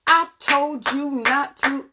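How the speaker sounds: high, drawn-out mom telling-off, word by word; mock scolding with every word punched. Mom to a little one in trouble, scolding emphatically. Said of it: "your mom be like".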